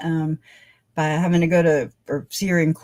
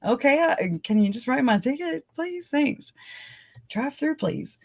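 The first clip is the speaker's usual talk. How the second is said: high, small polite voice; mock polite request; 8 semitones higher, 3 dB quieter than usual. Pulled-over driver asking officer for a ticket, high-pitched. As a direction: ask sweetly high, trailing softer.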